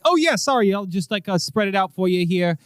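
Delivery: high flustered cop voice; caught cop. Acted. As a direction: scramble to comply.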